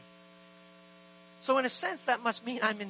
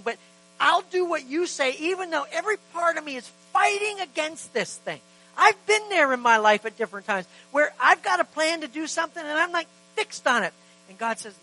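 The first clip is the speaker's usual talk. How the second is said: ordinary delivery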